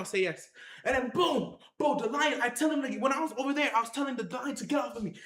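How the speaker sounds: normal talking voice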